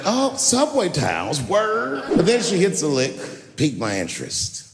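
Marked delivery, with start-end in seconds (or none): 0.0-2.0 polite. Retold or imitated. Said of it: "trying to be polite".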